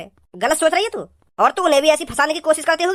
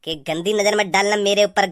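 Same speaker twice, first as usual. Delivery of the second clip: lower, slowed mimicry of her line; mock her warning in a lower voice; mocking imitation; 8 semitones lower, slower than usual. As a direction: throw her words back sneeringly. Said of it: "mocking repeat".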